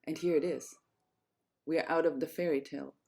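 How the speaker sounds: plain reading voice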